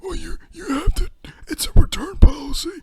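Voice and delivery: put-on high, whimpering, half-whispered old man's plea; mock-sobbing old customer insisting on his return; whiny old man. Old customer begging the clerk to take the bulbs back, hammed-up high whimper. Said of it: "And he'd be like".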